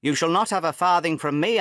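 loud, angry refusal; angry father refusing his son money; angry cry. Acted. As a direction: slam the door on his demand. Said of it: "I cried".

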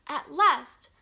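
loud, high happy announcing; happy announcement. Acted. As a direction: proclaim it with relief and joy. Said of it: "announced Pooh happily".

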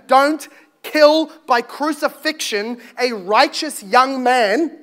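ordinary talk

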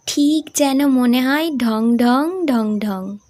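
slow, stretched-out words; drawling. Acted. Daughter imitating the station bell, slow drawl. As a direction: drag each word out like a bell. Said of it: "ও টেনে টেনে বলল".

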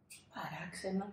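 hushed, soft wonder; whisper. A narrator's reading. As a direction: whisper it in suspense. Narrator wonders quietly. (ψίθυρος απορίας)

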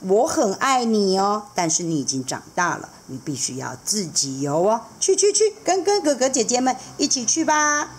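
fond seahorse dad. Acted. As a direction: gently scold the little rascal. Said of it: "还看着他说".

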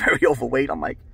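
usual speaking voice

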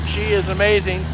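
ordinary talk

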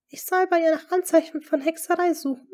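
high, loud disbelief; barely hide the disbelief; incredulous question. Acted. Lady Rochford to the duke, incredulous. Rochford asked to spy on queen.

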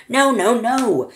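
high, loud refusal; yelling back. A narrator's reading. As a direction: shout down the objection. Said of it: "Mister Wonka yelled back".

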